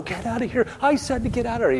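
high-pitched frantic yelled order, voice held back; mock an officer losing control, pitch way up; hollered police order. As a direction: overwrought cop shouting people away. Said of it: "doesn't have to run up and go".

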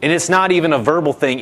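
ordinary delivery

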